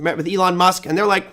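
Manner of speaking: ordinary talk, nothing marked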